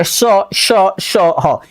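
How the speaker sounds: श as hushing "sh", then an airy ह; felt sibilants. Shown as a demonstration. Teacher making the warm air felt, airy "sh" and "h" on श, ह. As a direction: let the air rub out on each श and ह. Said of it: "इस पे जब आप feel करेंगे".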